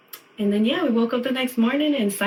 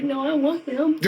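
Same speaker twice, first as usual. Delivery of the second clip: laughing; 8 semitones higher than usual. Retold or imitated. Retelling crying while refusing help.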